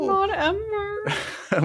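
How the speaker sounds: slow, high, hammed-up crying; mock wail. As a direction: wail in mock grief for the dog. Mock-mourning the falling dog.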